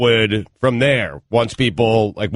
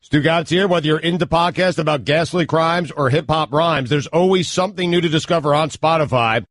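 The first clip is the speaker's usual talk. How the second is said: smooth, even sponsor read, close to his usual voice; podcast ad read; 5 semitones higher than usual. Acted. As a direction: sell it like a friendly host plug. Host reads a sponsor ad.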